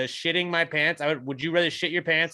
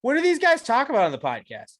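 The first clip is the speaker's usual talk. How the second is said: high-pitched put-on questioner; lightly voiced podcast listener; imagined listener; 12 semitones higher, 4 dB louder than usual. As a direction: ask as a curious outsider, pitched up. Imagined exchange about their podcast.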